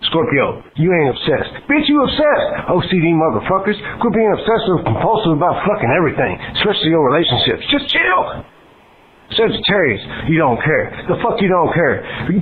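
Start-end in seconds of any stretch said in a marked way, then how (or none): 1.7-2.7 exasperated retort. Acted. Exasperated rant at Scorpio. 7.7-8.5 pleading high chill. Acted. Exasperated rant at Scorpio.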